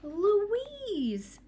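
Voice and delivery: high, loud happy call of her name; light up at the sight of her; joyful relief. Acted. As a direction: sister spotting her sister. Relieved sister finds sister.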